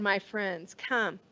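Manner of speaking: normal talking voice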